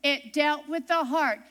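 ordinary delivery

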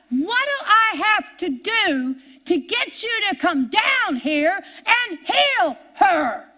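loud, high, pleading cry to God; frustrated outcry. Retold or imitated. Mother at the stove crying out to God, loud and desperate.

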